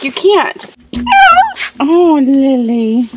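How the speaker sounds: loud laughing coo at the dog; laughing protest. Real, not acted. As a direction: laugh it out, drawn out. Teasing dog, lipstick lick.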